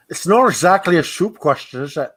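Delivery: put-on Scottish accent with slushy S sounds; put-on shushing Scottish voice; Sean Connery. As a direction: imitate a Scottish film star. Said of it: "I can do a really good".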